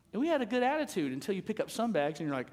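ordinary talk

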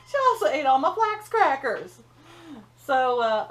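laughing through the words; chuckling as she tells on Hazel. Real, not acted. Grandma laughing to her viewers about Hazel eating her crackers.

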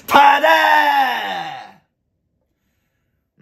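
long, high, drawn-out yell of "tada"; catchphrase shout. Acted. Comedian yelling his catchphrase at the audience, long and high. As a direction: belt it out, stretched and high.

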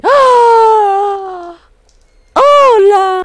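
high, loud sudden cry; startled scream. Real, not acted. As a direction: yelp at the jump scare. Jump scare in game.

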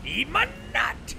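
high, loud battle taunt; taunting yell. Real, not acted. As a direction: shriek it at the enemy. Taunting the enemy while attacking.

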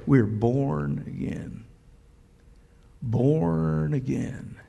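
slow, soft and reverent; hushed wonder at being born again; hushed reverence. Real, not acted. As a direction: let it land gently, almost awed. Reverent climax of the point.

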